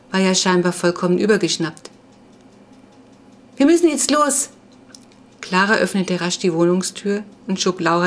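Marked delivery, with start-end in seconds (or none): 3.6-4.5 high-pitched, pressing interruption; urgent hurrying. A narrator's reading. Clara hurries her friend out, urgent and high. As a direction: cut in to get out quickly.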